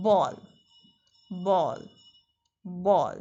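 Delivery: "ball" said short and low like bol, not baul; low, short "ball" repeated; correct bowl. Shown as a demonstration. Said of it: "Actual pronunciation is".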